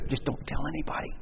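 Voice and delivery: hushed whisper, fast; an actor hiding his faith, whispering; secret whisper. Retold or imitated. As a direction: confide it under your breath.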